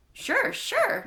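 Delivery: high, breezy double sure; easygoing teacher. Acted. Mister Grayson breezily answers Julio's question, high and casual. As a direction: toss it off lightly up high.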